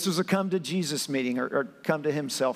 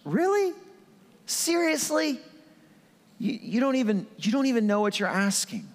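squeaky high "Really?", falling as he goes on; incredulous, pitched way up; high pitched disbelief; 6 semitones higher than usual. Retold or imitated. Preacher playing Jesus in shocked high disbelief. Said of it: "Like in a high pitched".

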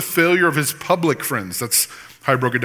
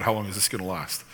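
dismissed question; 5 semitones lower, 8 dB quieter, faster than usual. Retold or imitated. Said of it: "your theology is askew".

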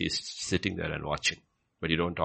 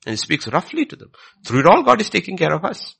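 higher and much louder preaching voice; full-force preaching; 13 semitones higher, 11 dB louder than usual. Acted. Preacher to congregation, fervent loud preaching. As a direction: drive it home from the pulpit.

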